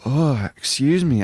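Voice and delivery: yawning mid-sentence; stifle a yawn. Real, not acted. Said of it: "I keep on yawning".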